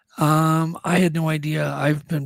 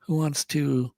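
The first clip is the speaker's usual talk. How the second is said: ordinary delivery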